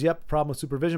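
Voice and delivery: usual speaking voice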